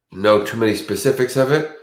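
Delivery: ordinary talk, nothing marked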